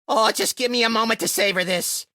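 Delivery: ordinary talk, nothing marked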